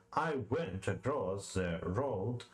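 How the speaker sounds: slow iambic beat on "I went across the road"; metrical read-aloud. Shown as a demonstration. Reader testing iambic rhythm, slowly beating out "I went across the road". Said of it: "say the following line aloud".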